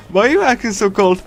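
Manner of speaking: ordinary delivery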